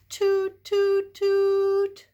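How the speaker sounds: high, loud toy trumpet toots; loud toot noises. Acted. Reader voicing the teddy bear's trumpet, loud toots. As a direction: blare it like a toy horn.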